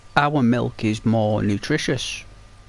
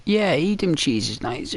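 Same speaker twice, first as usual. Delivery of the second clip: ordinary delivery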